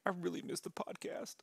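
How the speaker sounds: hushed, whispery imitation of co-host; mock whispered plea. Mock quote of the co-host's plea.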